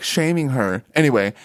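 usual speaking voice

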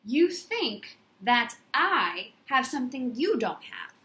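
slow, deliberate restatement; repeated for emphasis. Shown as a demonstration. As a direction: lean on every word, slowly. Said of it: "I'm gonna say that again".

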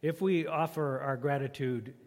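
ordinary talk, nothing marked

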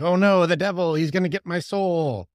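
high, loud hammed-up fright; exaggerated oh-no panic; mock panic. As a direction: wail it in fake terror. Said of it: "And you're like".

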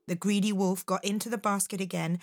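ordinary delivery, nothing marked

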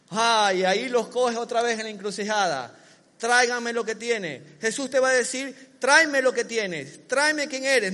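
loud, rising sermon cry; preacher calling out to the church; full-force preaching. Acted. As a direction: drive it home to the congregation.

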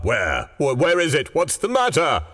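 startled stammer rising high; jolted, high-pitched old man in alarm. A narrator's reading. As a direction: wake in alarm, voice climbing. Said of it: "He started up in alarm".

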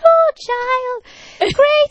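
put-on very high, exclaiming Irish accent; Irish priest. Priest greets the film-maker's request, high hammed-up Irish accent. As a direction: gush in a put-on Irish lilt. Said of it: "forgive my terrible Irish accent".

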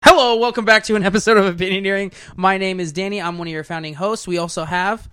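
loud, high-pitched show opener, easing down toward the end; big welcome-back announcer greeting; podcast host intro. Real, not acted. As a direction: launch the show with big projected energy. Redoes the show opening.

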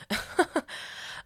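soft, airy chuckle; laugh. Real, not acted. Podcaster amused by her own Tide Pods joke, soft chuckle. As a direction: chuckle softly to yourself.

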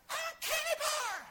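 put-on high, loud bratty child's shout; whiny child demanding candy. Child in grocery cart yelling at parent for candy, high whiny shout. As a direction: shout it like a kid begging at the checkout.

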